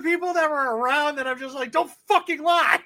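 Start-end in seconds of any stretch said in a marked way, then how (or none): 1.7-2.8 high, loud retold scolding; exasperated order to a liar; raised voice. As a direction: reenact an indignant outburst. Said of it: "I started raising my voice a little bit".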